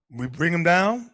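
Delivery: normal talking voice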